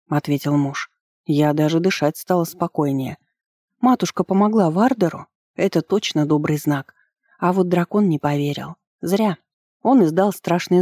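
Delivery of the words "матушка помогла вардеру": ordinary delivery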